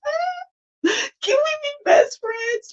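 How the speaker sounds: very high, drawn-out excited squeal; gushing mock squeal. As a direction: squeal it slowly like an instant best friend. Acts out gushing at new friend.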